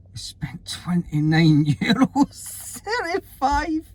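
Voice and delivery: laughing disbelief at the bill; shocked total. Real, not acted. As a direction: pitch shoots way up, slow and laughing.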